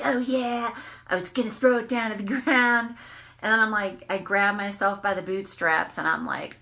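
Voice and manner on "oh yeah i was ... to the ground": mock tough talk. Retelling her triggered reaction.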